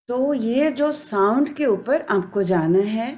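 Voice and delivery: ordinary talk, nothing marked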